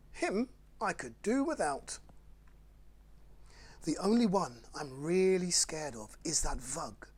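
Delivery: lively, sing-song picture-book read; bouncy storytime rhyme reading; reading to children. Acted. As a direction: read it like bedtime fun for little ones. Reads Dr Seuss to children.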